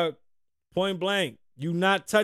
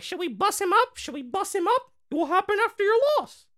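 very high cartoon mouse mimicry; Mickey Mouse-like voice. Mocking the cameraman's eager offer to jump him, squeaky cartoon voice. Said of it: "I sound like Mickey Mouse".

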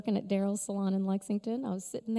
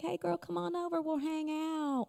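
put-on chummy girlfriend; 8 semitones higher, 3 dB quieter than usual. Voicing a casual friendly invitation.

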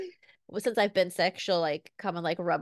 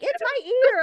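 high, loud mock wail; hammed-up little-kid complaint; whining toddler; 8 dB louder, slower than usual. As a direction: imitate a small child insisting on an ache. Mother voices child's complaint.

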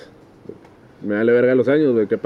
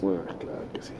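breathy whispered aside, much softer; whispering; 5 semitones lower, 15 dB quieter than usual. Real, not acted. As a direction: whisper it to yourself. Muttered aside, voice dropped away.

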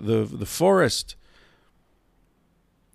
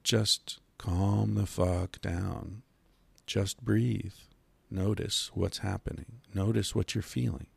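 slow, soft and soothing; calm therapist voice; 8 dB quieter, slower than usual. Retold or imitated. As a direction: talk someone down gently, very slowly. Psychiatrist's calming advice quoted.

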